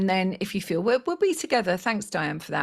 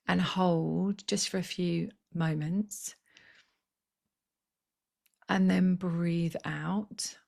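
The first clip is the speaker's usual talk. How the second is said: guided meditation. Acted. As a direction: talk them gently into their center. Leads breathing meditation.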